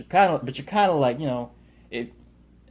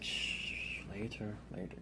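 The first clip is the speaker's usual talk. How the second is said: whispered shush, barely voiced; hushed; 2 semitones lower, 17 dB quieter, slower than usual. Euron hushing Jaime in public, whispering. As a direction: hush him so nobody overhears.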